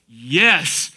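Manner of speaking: a pleased yes from Jesus; excited cheer. Retold or imitated. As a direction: cheer it, somebody gets it. Jesus cheering Peter's faith.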